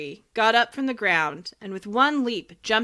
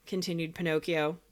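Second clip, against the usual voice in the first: usual speaking voice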